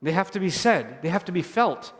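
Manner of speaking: slowing, softening triad; building emphasis. Real, not acted. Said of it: "these words are not just words".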